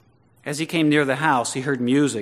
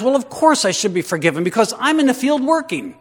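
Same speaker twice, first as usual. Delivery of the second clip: high, loud, self-righteous protest; aggrieved churchgoer protesting unfairness; indignant complaint; 9 semitones higher, 10 dB louder than usual. Retold or imitated. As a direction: whine it like you've been cheated. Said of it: "Because you think".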